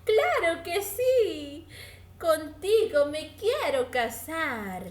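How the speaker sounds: high, loud and slow with joy; delighted mouse. Acted. The vain mouse accepts the cat's proposal, high and thrilled. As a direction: squeal a happy yes. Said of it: "dijo el gato".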